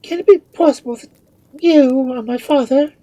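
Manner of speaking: very high, loud, stunned question; shrill amazed daughter; astonished cry. A narrator's reading. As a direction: cry it out in disbelief. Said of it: "cried Rosabella".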